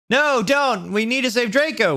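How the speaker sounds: high-pitched frantic plea; hammed-up pleading cry; begging. A narrator's reading. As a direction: beg desperately in a high voice. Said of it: "we begged".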